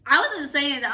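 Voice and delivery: loud, protesting denial. Real, not acted. Friend shouting her denial at the girl. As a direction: cut in loud to deny it.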